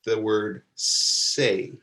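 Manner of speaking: "say" drawn out slowly, like the start of reservation; careful "say". Shown as a demonstration. Teacher modeling "say" for learners, slow and clear. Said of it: "this word is pronounced like the beginning".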